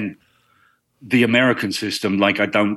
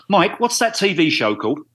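calling to a friend; 7 semitones higher than usual. Real, not acted. Calls to friend in the room.

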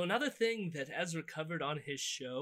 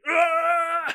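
loud high imitation of a gopher's scream; 13 semitones higher, 16 dB louder than usual. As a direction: scream out like a startled groundhog. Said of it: "A screaming gopher".

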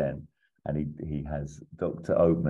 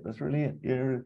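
higher, eager repeats of got it; excited; 9 semitones higher than usual. Retold or imitated. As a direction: grab the idea, a bit giddy. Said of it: "Oh, he's really excited".

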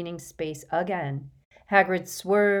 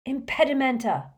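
yelled spell; 7 semitones higher, 3 dB louder than usual. Acted. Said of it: "and yelled".

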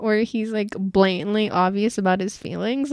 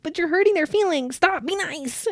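high-pitched mock pleading; pleading protest; 8 semitones higher than usual. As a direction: plead on behalf of someone's hurt feelings. Acted scolding plea of soft-hearted character.

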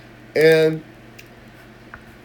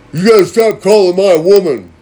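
loud, higher mock tough-guy warning; imitating the thug boyfriend; 6 semitones higher, 11 dB louder than usual. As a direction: ham up a dim, threatening boyfriend. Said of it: "He sounds like he has a third grade education".

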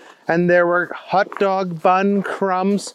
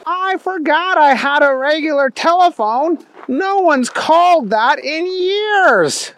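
high cartoonish voice, surprised; Booger McFarland; 9 semitones higher than usual. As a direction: exclaim it in a high, goofy character voice. Booger remembers his old telephone.

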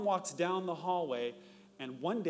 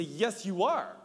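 louder, stubborn "Yes, you are"; playing himself in the quarrel, insisting; mock heated insistence; 4 semitones higher, 7 dB louder, slower than usual. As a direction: push back harder in a raised voice. Acted escalating quarrel with wife.

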